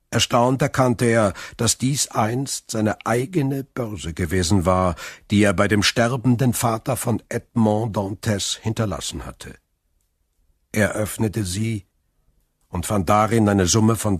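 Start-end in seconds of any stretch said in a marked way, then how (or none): none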